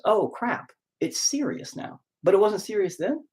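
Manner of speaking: high, mocking oh crap, trailing off breathless; a sarcastic, high imitation of sudden concern; mock sudden alarm. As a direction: ham up the fake shock.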